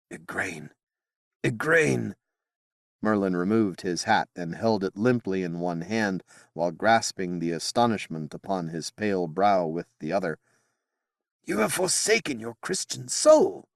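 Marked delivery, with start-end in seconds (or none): 0.1-0.7 soft, dismayed naming; stunned murmur. Acted. Merlin calls her name. 1.4-2.2 startled cry. Acted. Merlin calls her name. 11.4-13.7 high, outraged accusation; accusing cry. Acted. Merlin accuses Igraine.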